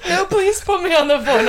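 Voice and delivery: high, shrieking laugh into pleading; laughing so hard it squeaks; helpless laughter. Real, not acted. Cracking up at her own Peppa voice, high and laughing.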